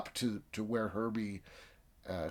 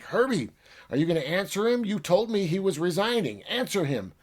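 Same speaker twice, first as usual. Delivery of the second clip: high, loud, demanding shout; angry yelling; 9 semitones higher, 6 dB louder, faster than usual. Retold or imitated. Scientist shouting at silent Herbie, angry and loud. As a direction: force the robot to answer.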